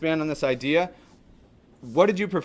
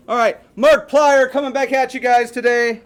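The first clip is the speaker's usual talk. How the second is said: excited intro; 9 semitones higher, 9 dB louder than usual. Real, not acted. Teacher opening video enthusiastically.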